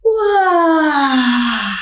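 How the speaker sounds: sleepy giant's grumble; giant's grumbling yawn. A narrator's reading. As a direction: groan it out like a giant shaking off sleep. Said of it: "abriu a boca e resmungou".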